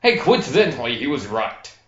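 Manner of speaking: normal talking voice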